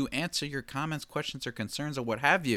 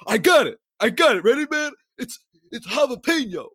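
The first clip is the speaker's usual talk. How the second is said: excited friend quote; 12 semitones higher, 7 dB louder than usual. Retold or imitated. Friend's quote retold, excitedly voiced.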